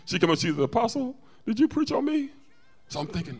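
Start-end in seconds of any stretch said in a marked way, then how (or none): 0.6-2.3 put-on small, high question from a young lady. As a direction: imitate her higher, softer voice. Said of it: "a young lady came up to me".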